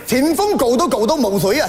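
garbled mock-Cantonese navigation; imitated Cantonese. Sat-nav babbles directions to lost driver, mock Cantonese. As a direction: rattle off directions in fake Cantonese. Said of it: "我导航还說話呢".

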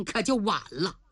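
usual speaking voice

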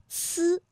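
呲 as a drawn-out flat-tongue c sound; flat-tongue initial. Shown as a demonstration. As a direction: hold 呲 with the tongue tip forward, uncurled. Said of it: "平翘舌音的连读". (把“呲”读成拉长的平舌音)

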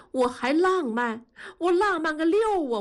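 high-pitched wounded outburst; hurt, aggrieved. A narrator's reading. Wife hurt by husband's mockery of her romance, aggrieved outburst. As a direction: throw it back bitterly, pitch rising sharply. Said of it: "伤心地说".

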